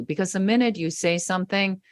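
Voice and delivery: ordinary talk, nothing marked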